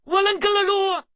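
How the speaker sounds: stern, shouted scolding; harsh telling-off at full voice; angry scolding. Acted. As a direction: scold forcefully, hammering out each order.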